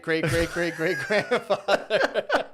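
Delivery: giggling as he drags out the joke; laughing through words. Real, not acted. Friend joking about Goliath, laughing through it.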